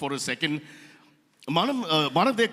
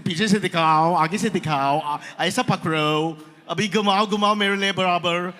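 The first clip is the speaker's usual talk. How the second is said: loud, put-on South Mumbai Hindi; mother's South Mumbai Hindi; 5 dB louder than usual. Mother inspecting a painting, South Mumbai Hindi. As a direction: order them about in exaggerated South Mumbai Hindi. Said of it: "When somebody came to show her a painting".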